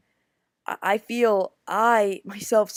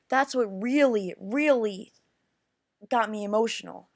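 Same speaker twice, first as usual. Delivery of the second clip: ordinary talk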